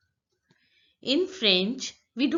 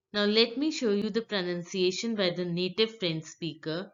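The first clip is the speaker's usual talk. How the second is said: ordinary talk, nothing marked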